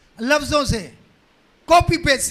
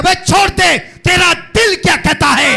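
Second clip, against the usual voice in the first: impassioned preaching; 6 dB louder than usual. Real, not acted. Preacher urging people to follow their own heart, building shout. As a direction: build from insistent repetition to a shout.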